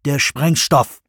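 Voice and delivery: firm, final command; no contradiction. A narrator's reading. Jones deciding on explosives, firm command. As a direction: say it flatly, allowing no argument. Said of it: "ließ keinen Widerspruch zu".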